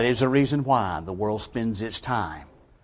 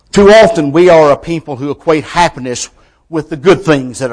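loud, high preaching climb; preacher rising to a ringing peak; full-force preaching; 7 semitones higher, 12 dB louder than usual. Acted. Preacher telling the congregation, at full force.

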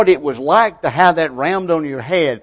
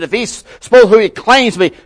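high, loud scoffing taunt; raised voice of a mocking leader; jeering challenge; 8 semitones higher, 6 dB louder than usual. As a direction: call out a scornful dare.

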